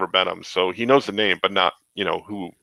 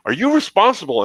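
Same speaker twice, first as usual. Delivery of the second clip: higher-pitched mock accusation; retold accusation; 8 semitones higher, 3 dB louder than usual. Idol confronting the artist over a comic, mock accusing. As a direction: put him on the spot, imitating an angry artist. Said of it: "like, pointing at me".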